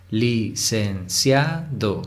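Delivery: "Licenciado" very slowly, syllable by syllable, evenly; separado en sílabas. Shown as a demonstration. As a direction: break "Licenciado" into li-cen-cia-do, unhurried. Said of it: "Vamos primero a separar en sílabas". ("Licenciado" sílaba por sílaba, lento)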